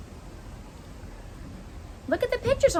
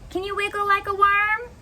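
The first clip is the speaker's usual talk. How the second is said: usual speaking voice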